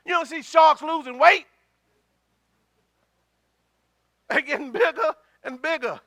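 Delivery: high, loud, excited preacher's riff; animated preaching. Real, not acted. Preacher riffs to congregation on well-fed sharks, animated preaching. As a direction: build to a loud rush with a chuckle in it.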